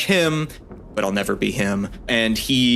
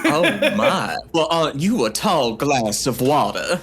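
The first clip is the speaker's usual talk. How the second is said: put-on Southern Belle accent; 3 semitones higher than usual. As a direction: play the swooning Southern Belle. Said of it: "Southern Belle accent".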